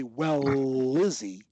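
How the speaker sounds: "Well, Izzy" slowly fused on a long L; made into one word. Shown as a demonstration. Coach contrasting linking with pausing, made into one word. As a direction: hold the L of "Well" right into "Izzy". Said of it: "if you make it one".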